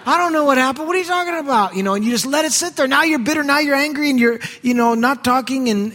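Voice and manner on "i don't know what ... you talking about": much higher, mock bewildered husband; husband who doesn't remember, confused; baffled husband. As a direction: play the clueless husband. Husband can't remember earlier events.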